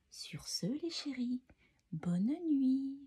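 very soft, hushed bedtime farewell; whispered good night. Real, not acted. Storyteller sending the little ones to bed, whispering.

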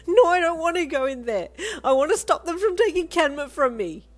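high, loud, distraught wail; sobbing protest. A narrator's reading. Child fights Lolita's grip, sobbing loudly. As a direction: cry it out desperately. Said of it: "you sobbed".

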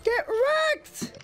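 high squeaky voice through a voice changer; voice changer girl voice. Acted. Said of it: "So I put on a voice changer".

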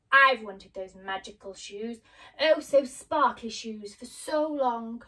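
loud, slow, covetous witch; gloating witch. Acted. Witch stares at Dorothy's shoes, gloating. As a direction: drag it out, coveting every word.

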